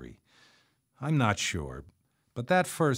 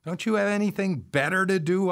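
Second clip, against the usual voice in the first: usual speaking voice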